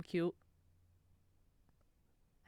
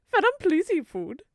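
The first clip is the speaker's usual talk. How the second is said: louder, higher exasperated begging; concerned plea; 7 semitones higher, 9 dB louder than usual. Real, not acted. Urging Venom to eat food, a concerned plea. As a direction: plead with them, loud and raised.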